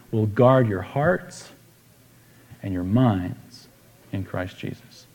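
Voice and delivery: slow, soft scripture reading. Acted. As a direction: read the verse slowly and gently. Reads scripture aloud.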